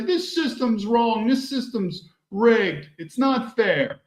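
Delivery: high, slow mock protest cries; protesting crowd. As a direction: plead like the angry poor, drawn out. Protesters chanting complaints.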